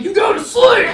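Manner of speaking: mock shout. Comic shouting at a man, mock order. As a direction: bark it like a final order. Acted quote repeating punchline.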